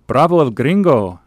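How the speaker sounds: high, loud, swooping shout; shouted cheer. A narrator's reading. Lady passenger cheering the traveler, shouted. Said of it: "shouted one of the ladies".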